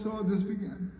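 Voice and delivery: ordinary talk, nothing marked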